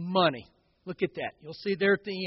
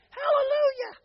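high-pitched imitation of a mocking churchgoer; weird mocking voice; 3 dB quieter than usual. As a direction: mimic a heckler with a weird high voice. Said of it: "the weirdest voice in the world".

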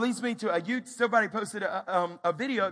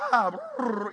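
loud high cry with a trill; peacock call; 4 semitones higher, 7 dB louder than usual. Retold or imitated. Dad showing daughter the peacock sound, squawking. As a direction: imitate a peacock, rolling the tail.

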